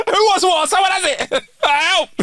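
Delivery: high-pitched comic calling out; squeaky put-on desperation; mock panicked call. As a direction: call out in hammed-up panic, laughing. Acted panic in coffee shop.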